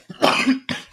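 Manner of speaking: a real dry cough mid-sentence. Real, not acted. Host coughing while explaining inflation data, real cough. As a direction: cough once, then carry on talking. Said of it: "Sorry, I got a cough".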